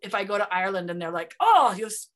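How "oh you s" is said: mock outraged cry, loud and high; upset outburst. Imagined Irish people reacting to the name, mock upset. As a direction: imitate offended locals exclaiming in protest. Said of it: "and they get upset".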